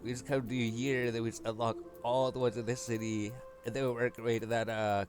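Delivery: normal talking voice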